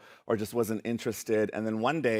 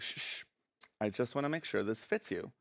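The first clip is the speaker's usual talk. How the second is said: hushing; 9 dB quieter than usual. Retold or imitated. Mom calming her worried child, hushed. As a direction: hush them and speak softly.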